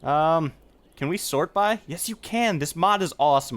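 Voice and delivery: ordinary talk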